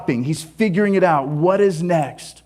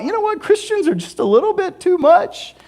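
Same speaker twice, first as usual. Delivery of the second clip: mock skeptic voice; 13 semitones higher than usual. Skeptic's thought voiced mockingly.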